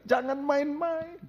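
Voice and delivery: preacher crying out a warning to the church, slow and high; shouted warning. Real, not acted. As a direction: call it out slow and high like an alarm. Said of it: "saya mau berteriak".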